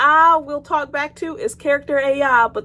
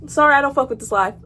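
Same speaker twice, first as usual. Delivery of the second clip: usual speaking voice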